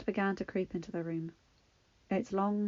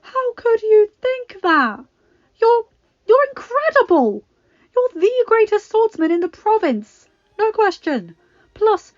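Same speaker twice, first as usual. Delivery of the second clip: indignant praise; 13 semitones higher, 15 dB louder than usual. Acted. Friend insisting he is incredible, high and fervent. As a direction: refuse to let him believe it. Friend protesting his worth.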